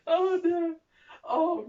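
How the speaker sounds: high laughing "oh no"; laughing dismay. Real, not acted. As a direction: laugh in horror at the mirror. Laughing at ill-fitting dress.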